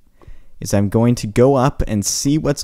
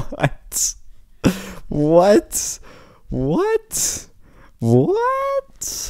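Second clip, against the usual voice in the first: each what climbs higher; rising confused whats; much slower than usual. Real, not acted. Lost gamer asking what, rising higher each time. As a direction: squeak it up higher every time.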